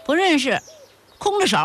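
put-on boy answering back. A narrator's reading. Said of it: "赵凯的声音回答".

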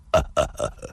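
low, hearty stage chuckle; storyteller's low acted laugh. Character chuckles at his friend.

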